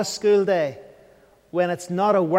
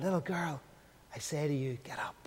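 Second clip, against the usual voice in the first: soft, lower, fading to a whisper; gentle whisper; 3 semitones lower, 14 dB quieter than usual. Pretend. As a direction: wake a child tenderly in a hush. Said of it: "almost like a whisper".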